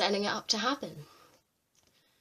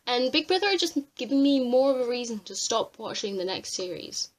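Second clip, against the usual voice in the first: normal talking voice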